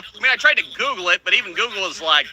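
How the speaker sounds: ordinary delivery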